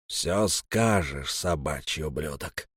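low slow menacing growl. Acted. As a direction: drag out the threat. Elm threatens.

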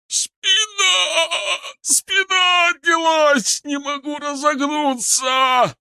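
high, drawn-out scream of pain; agonized howl. A narrator's reading. Шар cries out as his back gives out, agonized howl. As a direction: howl in agony, voice shooting right up. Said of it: "Раздался нечеловеческий полный агонии вой".

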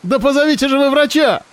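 high, frantic call for help; desperate shout. Acted. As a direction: scream over the shoulder. Girl screams for a doctor.

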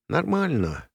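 high, softly wounded answer; hint at suffering while claiming to be fine; hidden tragedy. A narrator's reading. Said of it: "с как бы скрываемым трагизмом". (со скрываемым трагизмом)

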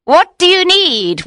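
high, loud witch's question. Acted. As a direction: ask like an eager old witch. Witch asks, first take.

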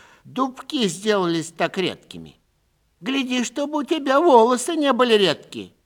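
a lady wagging her finger at a thieving steward; stern old lady. Acted. Old landowner scolding steward, high and sharp.

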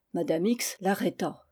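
plain reading voice

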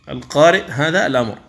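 ordinary delivery

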